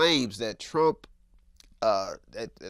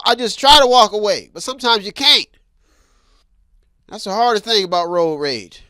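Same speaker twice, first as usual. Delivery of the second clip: usual speaking voice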